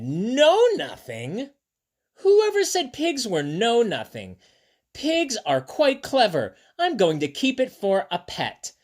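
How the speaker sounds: high, drawn-out indignant protest. Acted. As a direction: stand up for the pigs, affronted. Girl indignantly defends pigs.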